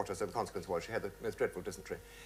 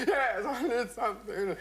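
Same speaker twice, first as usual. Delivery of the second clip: high, drawn-out mock sobbing; crying; 12 semitones higher than usual. Widow accepting a drink from the old man, crying. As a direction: wail it through pretend tears. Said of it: "Just crying".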